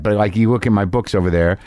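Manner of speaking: usual speaking voice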